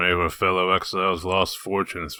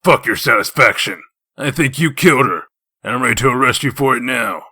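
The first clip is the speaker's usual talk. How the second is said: loud, contemptuous threat of arrest; angry retort; 4 semitones higher, 7 dB louder than usual. Acted. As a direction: spit the words back at him. Heated interrogation.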